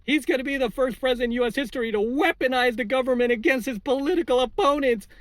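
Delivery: high-pitched parody of panicking lefties; mocking high voice. Vlogger mimicking freaked-out lefties, high mocking whine. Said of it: "I've literally heard them say this".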